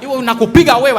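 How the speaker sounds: loud threatening soldier in Swahili; mock soldier threat. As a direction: snap the threat like an angry soldier.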